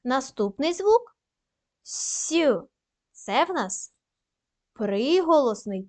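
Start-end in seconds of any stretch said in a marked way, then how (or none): 1.8-2.3 «с» as an isolated palatalized hiss, sʲ; lone soft «с», drawn out slightly; soft consonant sound. Shown as a demonstration. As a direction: sound out «с» softened, not as a letter name. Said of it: "М'який".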